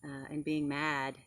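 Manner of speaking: put-on loud, lively read-aloud to kids; storybook reading. Teacher reading a picture book to kids, lively and loud. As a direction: read the story big and bright for the class.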